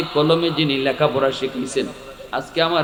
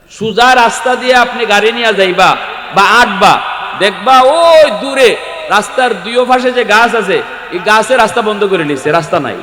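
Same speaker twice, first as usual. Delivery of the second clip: high, loud parable-telling to a congregation; impassioned preaching; 8 semitones higher, 8 dB louder than usual. Real, not acted. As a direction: project the example out to the crowd.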